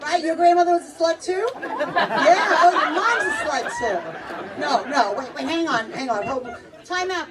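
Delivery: usual speaking voice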